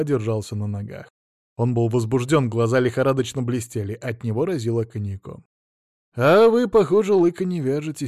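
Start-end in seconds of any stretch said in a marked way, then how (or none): 6.2-8.1 raised, louder opening jab; pointed remark. A narrator's reading. Officer to drunk Sila Ignatyevich, pointed. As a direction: say it as a pointed dig.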